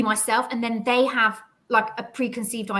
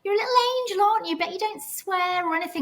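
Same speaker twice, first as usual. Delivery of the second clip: mocking high patronizing voice; 11 semitones higher than usual. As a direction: talk down to them like a little child, much higher. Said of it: "people go".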